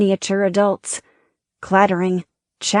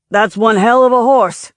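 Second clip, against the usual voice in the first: loud, higher hearty shout; calling out; 4 semitones higher, 6 dB louder than usual. A narrator's reading. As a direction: call across the box, booming. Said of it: "Babe Ruth called to him".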